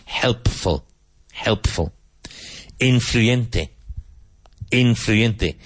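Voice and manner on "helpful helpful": HELPFUL with the P sounded before the F; clearly pronounced P. Shown as a demonstration. Teacher drilling learners on that P, careful "Helpful". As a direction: say "Helpful" keeping the P distinct. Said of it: "Be careful of pronouncing that P".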